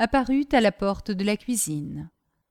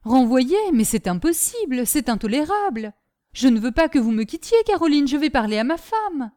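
startled protest, starting loud; indignant exclamation; 5 semitones higher, 5 dB louder than usual. A narrator's reading. Master refuses to lose his maid, indignantly. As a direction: object with urgent disbelief. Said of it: "s'écria monsieur Delmy en sautant".

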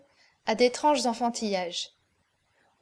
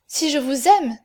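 high, loud ardent exclamation; ardente expansion; 4 semitones higher, 7 dB louder than usual. A narrator's reading. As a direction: burst out with ardent love. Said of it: "s'écria la jeune fille avec une ardente expansion". (s'écrier avec ardeur)

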